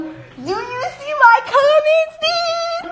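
high, slow singsong mockery; mock caller. Vlogger mocking a caller asking about comments, high singsong voice. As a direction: sing it back like a whiny pest. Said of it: "This fucker calls me talking about".

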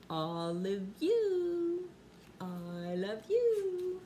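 slow and loving, stretched out; an affectionate slow reveal of a knock-knock joke; tender punchline. Acted.

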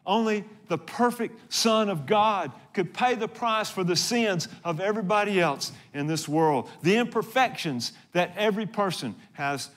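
ordinary talk

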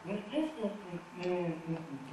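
soft humming with lips shut; closed-mouth hums. Shown as a demonstration. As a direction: hum the rhythm with your mouth closed. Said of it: "口結んで読むと".

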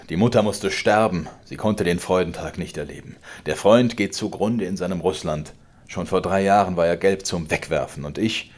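laut drohender alter Vater, höher und lauter; zorniger Vater. Acted. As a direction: schleudere ihm die Worte herrisch entgegen. Vater klagt an. (der Vater droht dem Sohn, laut und herrisch)

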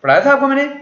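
normal talking voice

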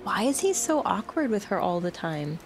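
normal talking voice

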